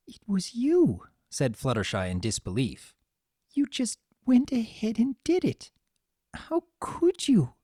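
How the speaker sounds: high, breaking disbelief; can't believe what he did; stunned disbelief. A narrator's reading. Fluttershy realising Discord cast the spell, high and stunned.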